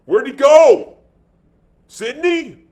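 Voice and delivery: high, loud, incredulous questions. A narrator's reading. Man presses for where the seller went, incredulous. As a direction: fire the questions out in high-pitched disbelief. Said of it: "Man was incredulous".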